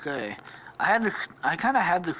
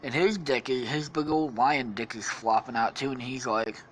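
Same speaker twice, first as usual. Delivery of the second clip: ordinary talk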